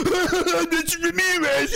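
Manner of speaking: high slow mocking of the name; mock pronouncing. Mocking a killer's name, high and slow.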